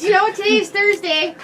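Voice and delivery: high, loud put-on voice for Jen; a loud high parody of a coworker; mock Jen voice. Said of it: "Jen goes".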